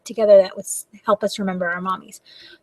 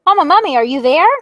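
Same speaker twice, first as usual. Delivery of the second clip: put-on higher, louder little child call; 6 semitones higher, 8 dB louder than usual. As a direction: call out like a lost little kid. Baby mummy calls out.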